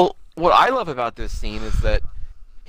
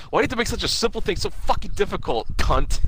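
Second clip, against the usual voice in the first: mock exasperated snap; 7 semitones higher, faster than usual. Character snapping while leaving.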